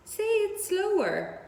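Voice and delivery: a little pig begging her to slow down; slow piglet. Acted. Wilbur asking the goose to repeat, high slow voice.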